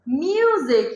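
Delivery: "म्यूजिक" with a drawn-out yoo; "म्यूजिक", first vowel a long yoo; long u sound. Shown as a demonstration. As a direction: stretch the myoo in "म्यूजिक". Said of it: "यू से म्यूजिक".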